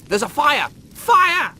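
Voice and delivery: high, loud hysterical shouts; panicked screaming. Acted. Rimmer shrieks at Lister about the fire, panicked screaming. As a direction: shriek the alarm over and over.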